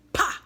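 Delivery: sharp spoken slap noise; slap sound. A narrator's reading. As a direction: smack out a loud Pah. Fairy slaps the doll.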